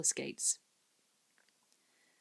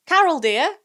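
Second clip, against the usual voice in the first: calling out; 12 semitones higher, 13 dB louder than usual. A narrator's reading. Mother calling daughter.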